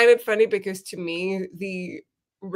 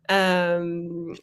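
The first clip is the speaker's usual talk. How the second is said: normal talking voice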